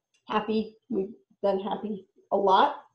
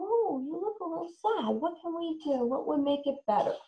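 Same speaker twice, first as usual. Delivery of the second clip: gentle sympathy; 7 semitones higher than usual. Shown as a demonstration. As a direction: comfort a child who looks sad. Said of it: "you could say".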